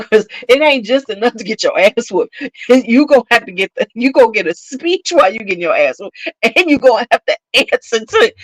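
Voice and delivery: ordinary talk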